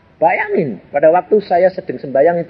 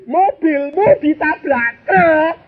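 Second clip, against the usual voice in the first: wife's yell again, high, slow and a bit louder; louder mock yell; 12 semitones higher, 3 dB louder, slower than usual. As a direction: yell it harder this time. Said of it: "teriaknya tambah kenceng". (teriakan istri yang makin keras, tinggi dan lambat)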